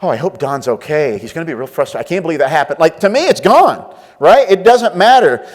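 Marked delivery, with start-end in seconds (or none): 0.0-2.8 a hushed imitation of lying awake worrying; softly brooding over Don; mock worry. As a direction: lower and softer, fretting at night. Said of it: "lay in bed at night going".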